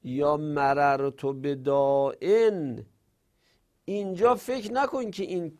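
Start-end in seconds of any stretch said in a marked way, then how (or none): 0.0-2.9 very slow, deliberate recitation of Arabic examples; slow example reading. Shown as a demonstration. Teacher reciting grammar examples to students, slow and drawn out. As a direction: draw each word out for the class.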